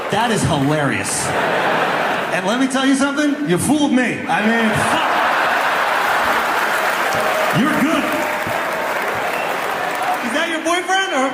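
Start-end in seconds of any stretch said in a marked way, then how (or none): none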